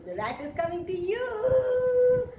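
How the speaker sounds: mock-scary singsong for children; spooky sing-song. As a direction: tease the kids that the rat is coming. Teacher spooks kids with rat.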